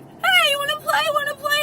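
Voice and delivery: very high, loud excited puppy voice; playful dog voice. Retold or imitated. Young dog pouncing on an old beagle, playful squeal. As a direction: squeal it like an eager pup. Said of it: "While this dog now is".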